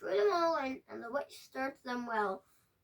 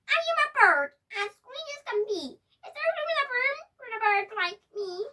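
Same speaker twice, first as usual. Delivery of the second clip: much higher, louder bird voice, faster at the end; squeaky bird voice; 10 semitones higher, 8 dB louder, faster than usual. Acted. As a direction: chirp it like an eager little bird. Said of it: "then said".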